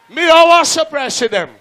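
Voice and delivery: loud announcement. Real, not acted. MC promises the crowd a surprise, loud hype announcement. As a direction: announce it to the whole dance.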